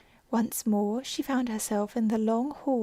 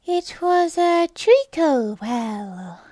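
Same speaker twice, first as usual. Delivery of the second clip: slow, high, drowsy little creature voice; sleepy Dormouse; 6 semitones higher, 8 dB louder, much slower than usual. Acted. Dormouse telling Alice its story, high and slow. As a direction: drawl it out like a half-asleep mouse. Dormouse explains at tea party.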